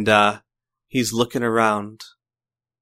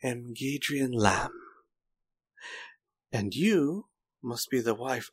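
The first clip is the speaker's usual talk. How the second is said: villain voice; 3 semitones higher, 4 dB quieter than usual. Acted. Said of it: "he stands up and gives a bow".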